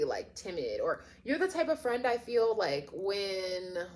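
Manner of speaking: ordinary talk, nothing marked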